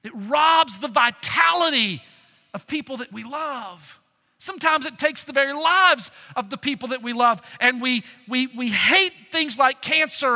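full-force preaching. Acted. Pastor preaching to the church at full force. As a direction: preach it out to the congregation.

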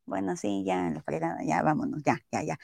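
low, soft grumbling clerk; grudging mumble. Retold or imitated. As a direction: give in with a resigned grumble. Vendedor fastidiado, a regañadientes. (murmullo resignado y grave)

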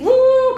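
high, sustained "uh" hoot; imitated party noisemaker. Shown as a demonstration. Doctor imitates emojis sent for birthday, high hoot. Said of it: "¿cómo le hacen?".

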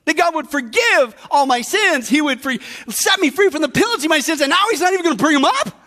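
high-pitched, amazed outburst of praise; Paul exulting. Acted. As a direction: draw out the praise, then rush on in disbelief. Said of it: "No wonder Paul's going".

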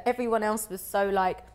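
ordinary talk, nothing marked

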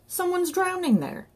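very high-pitched alarm call; high, urgent cry; shouted alarm. A narrator's reading. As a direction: shout for help across the pond. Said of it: "he shouted".

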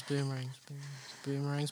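fading echo. Pretend. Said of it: "'cause it went away and it came back".

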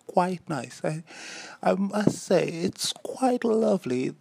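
high-pitched, polite British voice; British accent. Pretend. Podcaster praising a new word, mock-polite British accent. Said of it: "automatically I go into a British accent".

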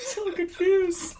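high slow fake sob; mock crying. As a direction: wail it like fake tears.

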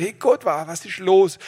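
high, loud exasperated plea; mock the healer crying out to God; retold cry to God. Preacher quoting the healer's loud, exasperated plea to God. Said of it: "Er sagt".

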